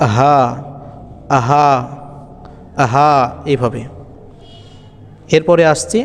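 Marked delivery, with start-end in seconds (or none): 0.1-3.1 আহা from the bottom of the throat, in a deep voice. Shown as a demonstration. Teacher demonstrating আহা to students, deep voice. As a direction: breathe আহা out deep and low. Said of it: "গম্ভীর আওয়াজে বলবো".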